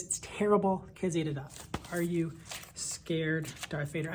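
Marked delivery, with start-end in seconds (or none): 1.9-4.1 a hint of a low, slow masked villain; Darth Vader voice. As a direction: read it low and slow like a space villain, lightly done. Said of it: "in a Darth Vader voice".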